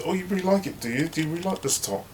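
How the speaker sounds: high gushing fake praise; fake-sweet gossip. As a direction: compliment her top insincerely, high. Mother hen fake compliment.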